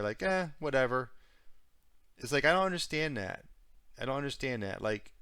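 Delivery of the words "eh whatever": a careless shrug of a line, a bit higher; imitating a debtor who doesn't care, brushing it off; mock dismissive. Mocking a debtor's attitude, a lightly shrugging "whatever". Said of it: "that kind of attitude".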